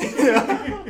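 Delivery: high, loud burst of laughter. Real, not acted. Co-host cracking up over a remark about teenagers, high loud laugh.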